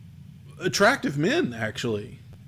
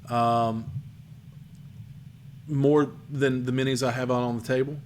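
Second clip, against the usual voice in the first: ordinary talk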